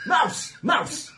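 calling out. Acted. Calling a friend across room.

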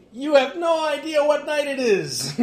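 high, loud crowing after a laugh; gleeful gloating. Acted. Froman gloats back at Remy, gleeful gloating. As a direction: revel in knowing what they don't.

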